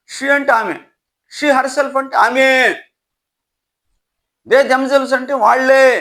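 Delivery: ordinary talk, nothing marked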